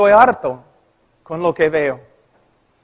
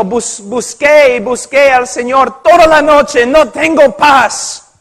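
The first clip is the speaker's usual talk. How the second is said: a woman at her wits' end pleading with the pastor; distressed woman; 6 semitones higher, 6 dB louder than usual. Retold or imitated. Woman back at church, pleading desperately with the pastor.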